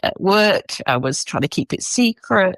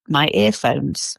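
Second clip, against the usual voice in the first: ordinary talk, nothing marked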